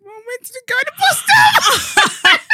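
can't get the words out for laughing; shrieking laugh mid-story; laughing loudly. Real, not acted. Laughing retelling mum's confrontation.

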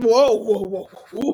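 loud, backing-off whoa; mock startled whoa. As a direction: imitate him jumping back. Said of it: "The minute I pulled your head".

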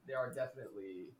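straining while grabbing the cat; distracted, wrestling a cat off the desk; holding down a pet. Real, not acted. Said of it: "are you wrestling the cat again?".

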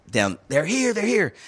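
high, excited calling down the stairs; calling the news, pitch climbing; wife calling out. Retold or imitated. Wife calling husband to the door, high and excited. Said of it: "Jennifer's like".

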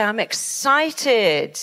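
slow, stretched-out excited declaration. Shown as a demonstration. Therapist showing what to say, excited. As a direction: draw out the word excited with relish. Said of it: "whatever you're doing, say".

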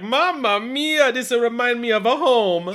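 slow, loud, high mock Italian; Italian accent. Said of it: "and you're like".

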